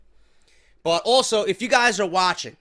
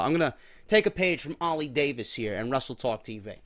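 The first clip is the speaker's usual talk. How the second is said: normal talking voice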